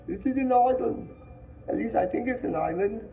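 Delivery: put-on the fair boy, thinking it through, excited. A narrator's reading.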